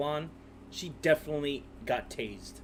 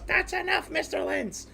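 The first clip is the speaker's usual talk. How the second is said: high-pitched mock fed-up judge; Judge Anzalone; 13 semitones higher than usual. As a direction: cut him off in a much higher voice, imitating an exasperated judge. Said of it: "Judge Anzalone just had enough".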